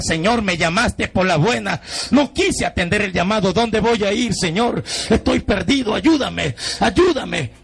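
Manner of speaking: high-pitched pleading to God; retold desperate prayer. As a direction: implore the Lord, acting out being lost. Reenacts desperate plea to the Lord.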